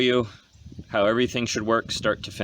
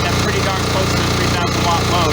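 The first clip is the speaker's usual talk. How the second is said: raised, high voice over a running motor; shouting over engine; 13 semitones higher, 8 dB louder than usual. Real, not acted. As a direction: project over loud machinery. Narrating beside a running generator.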